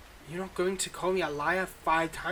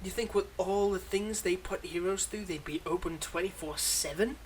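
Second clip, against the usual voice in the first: ordinary delivery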